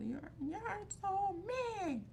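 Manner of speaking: put-on high, slow toddler whine; whiny toddler. Quoting her toddler to the group, high and slow. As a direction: stretch it out like a sulking little kid. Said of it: "he gets pissed off and says".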